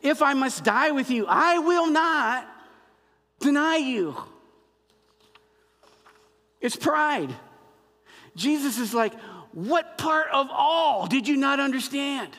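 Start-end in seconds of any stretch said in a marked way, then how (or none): 0.0-4.2 high, loud, emphatic boast; emphatisch, arrogant. Acted. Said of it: "he said emphatically or arrogantly". 9.5-12.3 high, emphatic incredulous retort; mock exasperation. Jesus's exasperated retort to Peter, acted.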